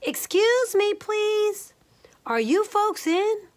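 calling out. A narrator's reading. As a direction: call politely into an empty house. Leola calling into house.

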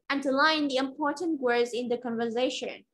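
brisk, linked-up model sentence; quick reading. Shown as a demonstration. Teacher showing learners how it sounds read fast, quick and connected. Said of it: "đọc nhanh sẽ là như thế".

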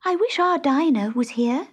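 put-on high girl voice; wishful high Alice. A narrator's reading. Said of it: "said Alice".

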